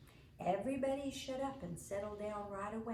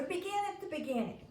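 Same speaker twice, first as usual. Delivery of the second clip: hollered; 9 semitones higher than usual. A narrator's reading. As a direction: holler it across the room. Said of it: "Imogene hollered at my mother".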